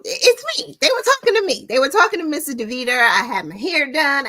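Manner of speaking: high, loud, excited self-hype; excited, high-pitched boast; playful bragging. Real, not acted. Boasting playfully about her interview.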